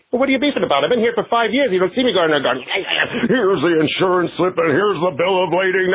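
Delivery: a fussy man fed up with waiting; Mister McDougal impression. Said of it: "Mister McDougal from Abbott and Costello Meet Frankenstein".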